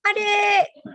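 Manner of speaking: high, loud shout for the little sister; yelling for sister. Retold or imitated. Older brother lazily yells for his younger sister, high loud shout. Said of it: "langsung teriak minta adiknya".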